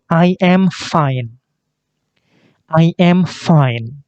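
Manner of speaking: slow model phrases for echoing; teacher drilling slow answers; repeat-after-me drill. Shown as a demonstration. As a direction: say each slowly for the class to copy. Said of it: "Ikuti saya, ikuti [name]".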